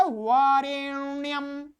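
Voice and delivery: वारेण्यम drawn out on a long retroflex 'n'; careful pronunciation. Shown as a demonstration. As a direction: hold the ण in वारेण्यम before 'yam'. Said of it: "इसका उच्चारण बहुत सावधानी से करना है". (वारेण्यम, सावधानी से लंबा ण)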